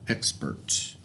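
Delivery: Expert whispered low and soft; whispered word. Shown as a demonstration. Teacher softly repeats expert to the class.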